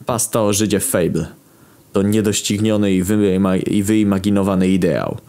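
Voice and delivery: usual speaking voice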